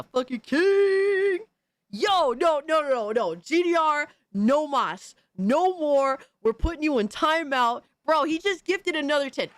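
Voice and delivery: high, loud hyped yelling; excited shout. Real, not acted. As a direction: yell with joy at a big gift. More gifts arrive.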